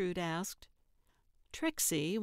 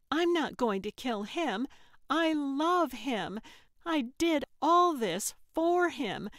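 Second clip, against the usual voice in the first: impassioned; 6 semitones higher, 3 dB louder than usual. Acted. Trixie insisting she loves Frank, rising passionate outburst. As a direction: build the feeling to a peak. Trixie defending her love.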